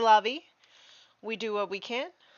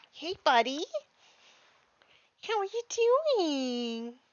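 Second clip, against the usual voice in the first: talking to the dog; 9 semitones higher, 3 dB louder, slower than usual. Real, not acted. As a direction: coo up high at the puppy, stretching the words. Greeting the dog coming in.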